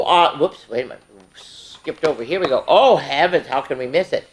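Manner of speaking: normal talking voice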